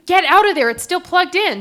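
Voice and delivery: high, loud alarmed shout; retold cry of alarm; urgent warning. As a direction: yell a danger warning, imitating a worried janitor.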